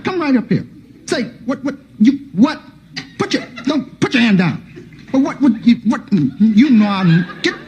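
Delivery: lightly voiced dad calling the kid over; father summoning his son for a beating; stern father.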